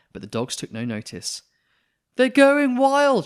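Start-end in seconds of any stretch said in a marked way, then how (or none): 2.2-3.3 loud, high and slow alarmed cry; loud, high, drawn-out panicked shout. Acted. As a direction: yell over the chaos. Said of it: "shouted the nurse".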